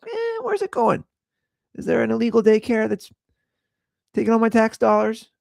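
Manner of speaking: high, sceptical mock complaint; suspicious grumbling. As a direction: question it like a put-out taxpayer. Acts out a grumbling suspicious taxpayer.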